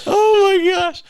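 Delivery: high-pitched laughing fit; laughing hard. Real, not acted. Host reacting to co-host's blunt verdict, laughing hard. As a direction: crack up, then squeal through it.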